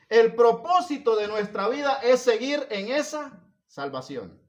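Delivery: loud, high, emphatic sermon voice; full-force preaching. Real, not acted. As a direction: drive each point home to the congregation at full volume.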